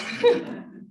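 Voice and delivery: high breathy nervous laugh. Real, not acted. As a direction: laugh off the tears. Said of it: "this part makes me kind of emotional".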